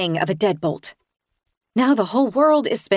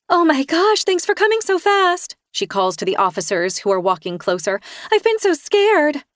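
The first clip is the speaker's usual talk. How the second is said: playing the victim, higher; fake scared; 7 semitones higher than usual. A narrator's reading. Kirsten acting scared for officers.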